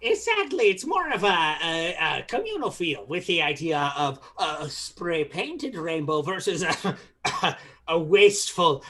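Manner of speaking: put-on Irish accent. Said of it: "making me do the accent".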